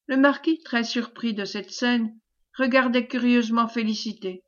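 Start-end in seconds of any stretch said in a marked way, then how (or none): none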